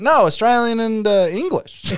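put-on high American country accent; country American woman. American woman correcting an Australian visitor, high country accent. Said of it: "very American, like, country-ish woman".

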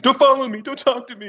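put-on sulky kid. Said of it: "He's like a five-year-old kid".

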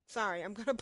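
ordinary talk

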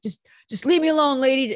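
high, loud mock protest; upset brush-off. Imitating desperate driver rebuffing the bandleader, loud and upset. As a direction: push her away with a loud, high plea. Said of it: "he just cut this huge promo".

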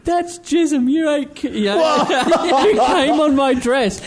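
very high mock-outraged woman, breaking into laughter; outraged bride. Bride accusing the groom over her dress, hammed-up outrage. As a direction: play her disgust loud and high, nearly cracking up. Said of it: "And she goes".

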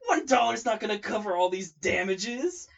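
loud, high outraged voice; outraged vendor yelling at Mama; indignant yell. Retold or imitated. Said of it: "the lady goes".

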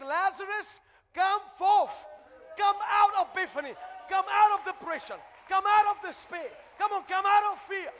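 loud, high preacher's call; commanding shout. Acted. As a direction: thunder each command to the congregation. Preacher calls out as Jesus.

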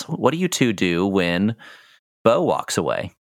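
usual speaking voice

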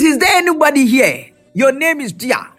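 normal talking voice

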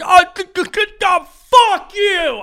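high, loud stammer that blurts out a curse; a cornered sputter that finally snaps; high, loud, stammering mock outburst; flustered outburst. Flustered by too much niceness.

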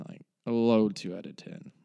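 usual speaking voice

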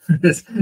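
loud high burst of laughter. Real, not acted. Guest greeting the host on returning to the podcast, laughing loudly.

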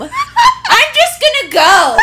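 high, slow laughing shout; loud giggly announcement; announcing departure. Retold or imitated. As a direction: call it out to the whole room while cracking up. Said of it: "I'm announcing my departure".

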